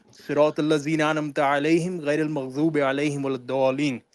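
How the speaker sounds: fast, flowing chanted Arabic recitation; Quran recitation. Real, not acted. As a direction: rattle the Fatiha off in a quick, steady chant. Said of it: "recite Surah Fatiha".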